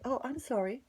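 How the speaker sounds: high-pitched imitated apology; light, high mimicry of saying sorry; mock apology. Woman apologizing, retold.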